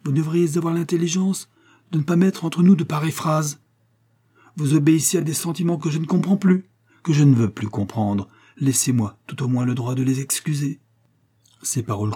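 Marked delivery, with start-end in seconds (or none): 0.0-10.8 higher, raised, offended voice; indignant son. A narrator's reading. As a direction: answer with wounded pride. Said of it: "reprit Ned indigné".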